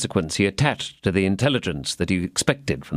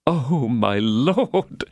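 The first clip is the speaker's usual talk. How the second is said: high, very slow, overwhelmed sigh of a mercer; swooning mercer; 3 semitones higher, much slower than usual. A narrator's reading. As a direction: melt with gratitude. Bonacieux voiced.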